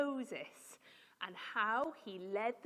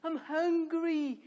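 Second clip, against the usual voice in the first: loud, slow, higher whine; hammed-up grumbling; 5 semitones higher, 8 dB louder, much slower than usual. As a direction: moan it out loudly, dragging each word. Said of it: "they started grumbling".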